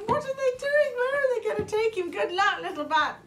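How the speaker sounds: high, fast excited cheer, pitched well up; cheering Quoll. Acted. Quoll cheering Little Bat off on the first flight, high excited cheer. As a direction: cheer him off, thrilled and squeaky. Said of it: "cheered Quoll".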